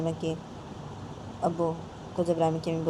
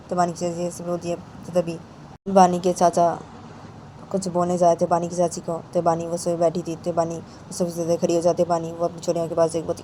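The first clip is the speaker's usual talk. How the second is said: ordinary delivery, nothing marked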